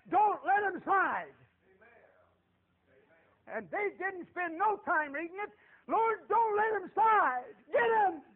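high, loud revival-preacher delivery; full-force preaching. Real, not acted. Preacher urges congregation to do something for God, impassioned shouting. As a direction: hammer each phrase at the congregation.

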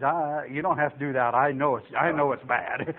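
low and soft, resigned; embarrassed mumble. Retold or imitated. Recruit to head-shaking officer, low embarrassed admission. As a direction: own up quietly, haltingly.

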